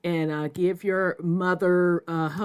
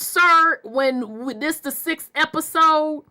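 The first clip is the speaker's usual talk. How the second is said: heated; 7 semitones higher, 3 dB louder than usual. Real, not acted. Arguing with a commenter.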